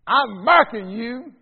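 loud, slow, high-pitched Deep South drawl with a British tinge; mock Deep South drawl. Missionary confronting a divisive lady, in a hammed-up Deep South drawl. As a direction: stretch it out big in an overdone southern drawl mixed with British. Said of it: "however you would say it if you were from the deep south".

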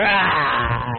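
very low, growled caveman grunt; Neanderthal grunt. Retold or imitated. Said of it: "It's like some Neanderthal".

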